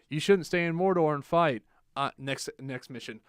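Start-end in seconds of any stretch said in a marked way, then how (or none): 0.1-1.6 loud, higher mock-heroic protest; emphatic objection. Player warning a Gondorian against staying, loud protest. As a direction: push it out loudly as a warning.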